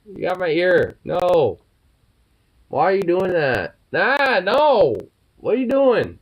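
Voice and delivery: loud high yelps; yelping protest. Real, not acted. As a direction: yelp as your ear gets nipped. Bird biting ear, yelping.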